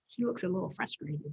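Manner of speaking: plain reading voice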